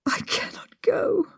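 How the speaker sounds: high, breathless whisper, choking on tears; broken, tearful whisper; whispered through sobs. A narrator's reading. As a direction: sob the words out in a whisper, slowly. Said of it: "I said through sobs".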